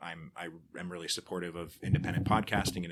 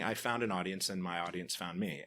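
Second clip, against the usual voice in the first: usual speaking voice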